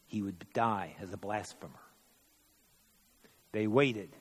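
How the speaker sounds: usual speaking voice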